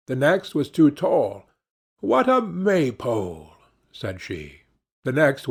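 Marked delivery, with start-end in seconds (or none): 2.0-3.5 mildly spiteful, higher-pitched jibe. A narrator's reading. Princess mocking the too-tall suitor, lightly spiteful. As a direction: poke fun with a touch of spite. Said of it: "something spiteful to say".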